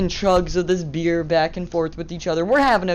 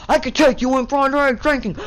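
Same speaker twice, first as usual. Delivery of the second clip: higher, louder cop's yell; mock officer yelling; retold yell; 10 semitones higher, 4 dB louder than usual. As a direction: bark the threat at the laughing kids. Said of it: "he just yelled at them".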